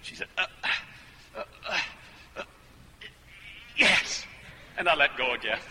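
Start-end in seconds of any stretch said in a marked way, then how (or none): 0.4-4.3 halting "uh"s, then yes; imitated hesitant yes. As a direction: stammer your way to agreement. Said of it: "Came a little easier".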